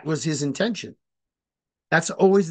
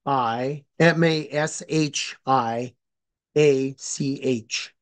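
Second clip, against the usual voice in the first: spelling out letters; slower than usual. Shown as a demonstration. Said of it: "I can give you the spelling".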